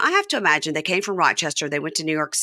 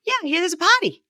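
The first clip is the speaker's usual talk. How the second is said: higher put-on voice; high mock John; 12 semitones higher than usual. Host imitates John hyping the party, high put-on. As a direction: chirp it up high like an eager guest. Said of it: "And he goes".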